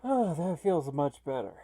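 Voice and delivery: normal talking voice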